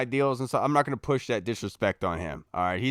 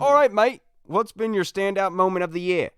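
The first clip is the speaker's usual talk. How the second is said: bright Australian twang, much higher and louder; Australian accent; 8 semitones higher, 6 dB louder than usual. Retold or imitated. Host reading a listener question in Australian accent. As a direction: toss it off like a cheery Aussie host. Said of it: "I don't know why it's Australian".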